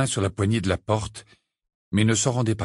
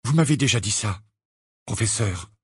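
curt. Acted. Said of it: "répondit brutalement Harry".

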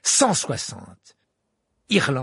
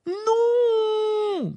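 long, high roar of protest; drawn-out high yell; 4 dB louder than usual. A narrator's reading. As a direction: howl it out in one stretched cry. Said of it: "rugit Ron".

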